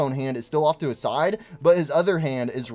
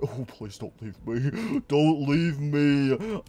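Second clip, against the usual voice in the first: hammed-up sadness; mock pleading; much slower than usual. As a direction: whine to be taken along. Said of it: "He's like".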